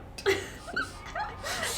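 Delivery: squealing laugh; high-pitched laugh. Real, not acted. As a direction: burst into high giggles.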